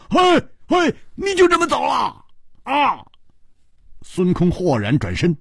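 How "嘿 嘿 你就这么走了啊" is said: very high-pitched acted shout; loud shout. A narrator's reading. Killer shouts after his departing partner, loud and high. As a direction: call out high after someone leaving. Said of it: "大声说".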